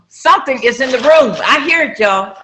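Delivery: high, loud frightened cry; screaming in fright. Retold or imitated. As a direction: yell it out, scared of the noise. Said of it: "jumped up screaming and told him".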